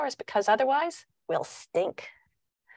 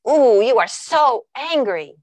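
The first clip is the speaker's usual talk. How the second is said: put-on louder, slow, matching the child's emotion; genuine empathy; 4 semitones higher, 8 dB louder, slower than usual. Shown as a demonstration. Parent genuinely matching an angry child's emotion, loud and slow. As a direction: mirror the child's anger with real feeling. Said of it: "match the emotion and be genuine".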